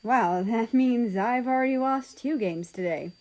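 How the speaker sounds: ordinary delivery, nothing marked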